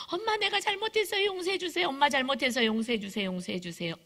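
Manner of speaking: put-on high childlike wail sinking as it goes on; pleading child. Child begging mom through a locked door, pleading. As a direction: beg over and over like a desperate kid.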